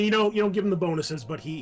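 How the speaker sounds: ordinary talk, nothing marked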